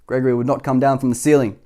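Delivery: ordinary delivery, nothing marked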